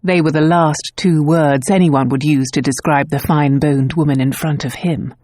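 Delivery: ordinary delivery, nothing marked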